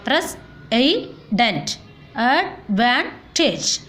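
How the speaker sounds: slow, broken into separate syllables. Shown as a demonstration. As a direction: pause after each syllable, teacher-style. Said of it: "Words having three syllable".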